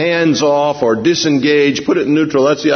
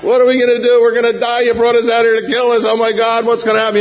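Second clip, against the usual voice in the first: hammed-up terrified crowd; crying out in panic; 10 semitones higher, faster than usual. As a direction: wail at Moses in fear. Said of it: "Now they're crying out".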